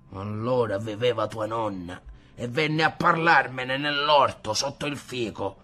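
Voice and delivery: an offended grandfather lecturing; grandfather scolding. Acted. (il nonno offeso che rimprovera ad alta voce)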